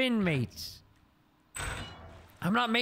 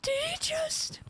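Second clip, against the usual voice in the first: stunned whisper; slower than usual. Real, not acted.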